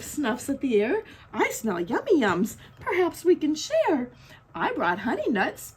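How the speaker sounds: lively storybook narration with swooping pitch; reading to children. A narrator's reading. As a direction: read it like a picture book to little ones. Reading picture book aloud.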